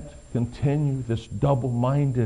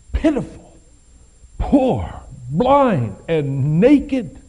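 louder and higher, slow and pounding; preaching at full force; 8 semitones higher, 7 dB louder, slower than usual. Acted. Preacher reading Jesus' rebuke to the church, full force. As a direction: hammer each word home like a judgment.

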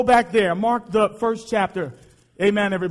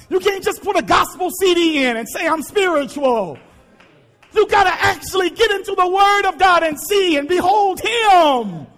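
raised, rousing sermon voice, climbing to shouts; preaching at full force; 5 semitones higher, 3 dB louder than usual. Acted. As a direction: pastor drives home obeying the Father, preaching at full force. Preacher builds again after the calm.

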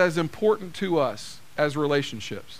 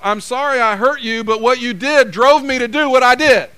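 louder, defensive sorry-but-it's-your-fault; hammed-up defensive apology; mock excuse apology; 4 semitones higher, 7 dB louder than usual. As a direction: blame them while pretending to apologize. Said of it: "something that sounds something like this".

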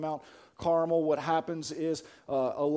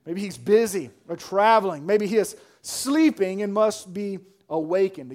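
raised, loud teasing speculation; taunting; 4 semitones higher, 7 dB louder than usual. A narrator's reading. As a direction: needle them from the pulpit. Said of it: "He's taunting them".

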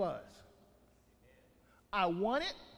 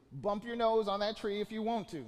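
ordinary delivery

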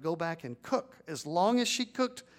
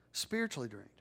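softer, falling repeat of the phrase; quiet echo; 7 semitones lower, 7 dB quieter than usual. Shown as a demonstration. Pastor echoing to the congregation, softly. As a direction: let it sink in, low and soft.

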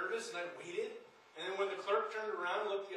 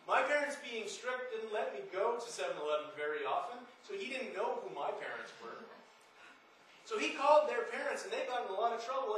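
usual speaking voice

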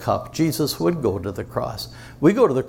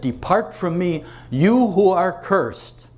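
higher, louder, slow commanding voice; stern condemnation; 7 semitones higher, 5 dB louder, slower than usual. Acted. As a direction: pronounce the curse with full weight. God the Father condemning the Son.

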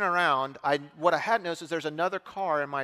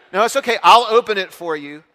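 take-charge stranger; 2 semitones higher, 9 dB louder than usual. Retold or imitated. Good old boy steps in.

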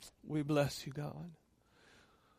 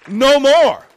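higher and much louder, shouting; defiant shout; 12 semitones higher, 16 dB louder, slower than usual. Acted. Preacher urging the church to declare, shouting defiantly. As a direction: roar it, claiming your home. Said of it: "get an attitude about your spiritual life and say".

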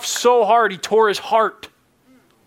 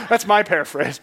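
ordinary talk, nothing marked

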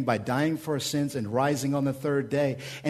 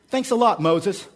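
sarcastic; 6 semitones higher, 6 dB louder than usual. Acted. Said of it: "they say to Moses".

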